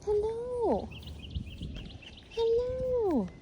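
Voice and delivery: high, soft soothing dog talk; calming a scared dog. Real, not acted. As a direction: coax a frightened dog gently. Coaxing scared dog in video.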